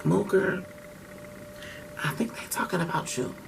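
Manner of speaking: hushed aside. Retold or imitated. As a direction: lean in and tip him off quietly. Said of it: "she looking like".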